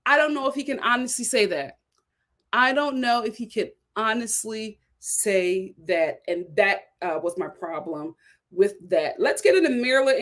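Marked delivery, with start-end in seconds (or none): 2.5-6.2 slowed right down, pausing between words; slow, deliberate repeat. Real, not acted. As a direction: spell out the doubt word by word. Repeats doubt slowly for emphasis.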